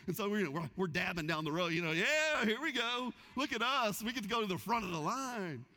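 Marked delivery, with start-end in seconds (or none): 1.9-5.7 gleeful boasting. Retold or imitated. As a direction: show off like a VIP cutting the line. Strutting past the crowd.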